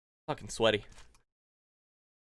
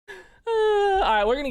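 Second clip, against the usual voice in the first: high, slow, strained groan of effort; strained squeak; 4 dB louder, slower than usual. Real, not acted. Streamer straining through push-ups, strained squeak. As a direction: squeezes the words out mid push-up.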